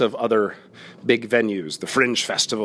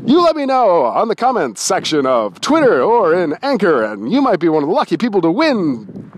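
high, loud contest announcer; loud, high-pitched quiz host delivery; game show host; 8 semitones higher, 6 dB louder than usual. Acted. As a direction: belt out the quiz options with big energy. Hosts a quiz loudly.

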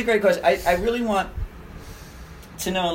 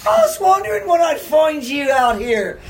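high, loud Irish shout of greeting; imitated Irishman calling out, high-pitched; Irish accent; 10 semitones higher, 4 dB louder, slower than usual. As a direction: hail a long-lost cousin in an Irish brogue. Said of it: "I heard the Irish accent".

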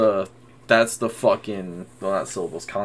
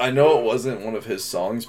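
ordinary talk, nothing marked

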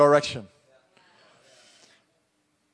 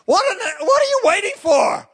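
exasperated shout; 4 dB louder than usual. Real, not acted. Pastor shouting at hesitant congregation, exasperated. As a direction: shout it high and loud in disbelief.

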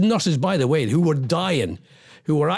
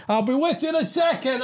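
much higher, strained exasperated cry; exasperated outburst; 10 semitones higher than usual. Real, not acted. Host venting at listeners mid-rant, much higher exasperated cry. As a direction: let the frustration burst out high. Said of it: "I just need to get it off my chest".

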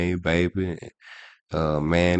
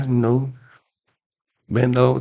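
ordinary talk

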